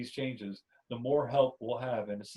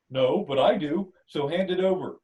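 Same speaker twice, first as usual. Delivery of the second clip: loud, insistent demand; 5 semitones higher, 9 dB louder than usual. Acted. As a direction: claim the pudding firmly. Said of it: "you demand".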